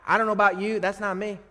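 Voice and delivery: usual speaking voice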